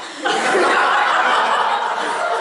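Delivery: loud breathy laughter. Real, not acted. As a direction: laugh at the punchline. Laughing at own story.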